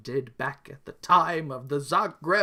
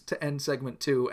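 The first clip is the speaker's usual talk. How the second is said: ordinary delivery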